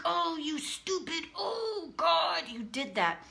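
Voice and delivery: high, hammed-up self-reproach; mock self-scolding. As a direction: act out berating yourself. Mock self-scolding she says she avoided.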